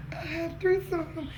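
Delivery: excited. Real, not acted.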